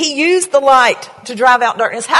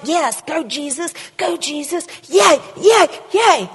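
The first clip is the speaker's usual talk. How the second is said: high cheering squeals of go Jesus and yay; mock cheerleader; 6 semitones higher than usual. Preacher mocking the church as Jesus's cheerleaders, high cheering. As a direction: cheer like a giddy fan in the pews. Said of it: "Like we're his cheerleaders".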